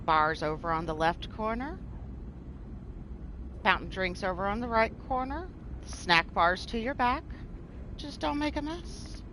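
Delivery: soft, soothing cabin-crew patter with a touch of ASMR; airline stewardess announcement. Pretend. Host pointing lurkers to bars and snacks, soft stewardess announcement. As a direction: welcome them aboard in a hushed, soothing announcement voice. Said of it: "airline stewardess style announcement".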